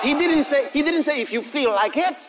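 high, loud, fervent sermon shouting; full-force preaching. Acted. Preacher rousing the congregation, full force. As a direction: preach it at the top of your voice.

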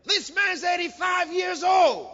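high, loud preaching shout; astonished shout. Real, not acted. Preacher marvelling at the old man's age, astonished shout.

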